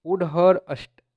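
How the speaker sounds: ordinary talk, nothing marked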